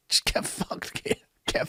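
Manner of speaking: whispered dismissal; contemptuous whisper. Real, not acted. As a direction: hiss it under your breath with contempt. Contemptuous aside to Keir.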